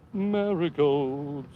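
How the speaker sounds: Marigolds stretched very slow with a fall; slow dreamy drawl; drawn-out marigolds. Acted. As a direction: linger on Marigolds like savoring it. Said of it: "my golf commentating voice".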